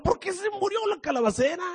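angry Jonah whining. Acted. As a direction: plead and protest like a spoiled child. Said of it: "se sentó más enojado".

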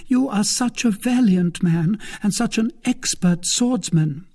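high, emphatic praise; high-pitched, insistent flattery; crying out. A narrator's reading. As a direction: exclaim in a high woman's voice. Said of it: "cried Milady".

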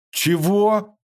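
резкий высокий громкий вскрик; выпали глаза от удивления, выкрикни; изумлённо. A narrator's reading. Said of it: "вытаращил глаза Михаил". (ошарашенное «Чего?», выше и громче)

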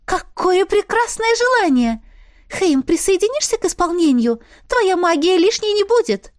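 high bubbly spirit voice; a tiny excited winter sprite. A narrator's reading. (высокий голосок духа)

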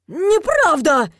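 high, loud, drawn-out squeal; shrill, desperate protest; shrieked denial. A narrator's reading. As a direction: squeal it in panic. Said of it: "взвизгнул призрак".

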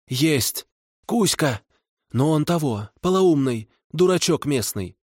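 high grudging hag voice; kikimora voice. A narrator's reading. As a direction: answer reluctantly, high. Kikimora answering the vodyanoy.

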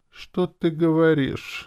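high, drawn-out mock astonishment; theatrical high-pitched disbelief; exclaiming. A narrator's reading. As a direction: exclaim in a slow, high she-demon voice. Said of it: "воскликнула демон".

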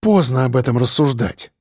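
usual speaking voice

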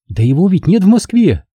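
put-on high, girlish exclamation; Lyuba, ardent girl. A narrator's reading. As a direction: answer high, correcting him. Girl answers in her voice.

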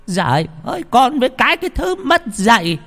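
put-on very high, loud old-woman shouting; angry shrieking scold. Grandmother outraged at half a duck, loud high shriek. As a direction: screech the insult at full pitch. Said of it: "Bà Sáu quát lên".

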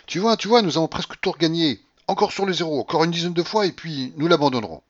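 high, loud excited urging; excited old gambler. A narrator's reading. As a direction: urge the companion on eagerly.

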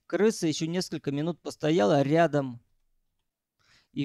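ordinary delivery, nothing marked

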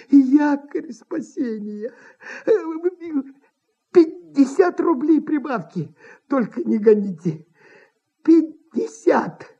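hushing, then slow wheedling offers; desperate bargaining in character; pleading impresario. A narrator's reading. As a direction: coax and beg not to be thrown out.

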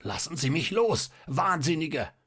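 high, strangled cry; desperate, choking protest; half-choked scream. A narrator's reading. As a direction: scream as if the throat is gripped. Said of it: "schrie der Doktor mit schon halb erstickter Stimme".

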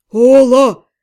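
loud yell across a crowd; shouting. A narrator's reading. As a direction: call out loudly. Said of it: "заорал вынырнувший откуда-то Отто".

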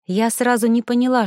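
ordinary delivery

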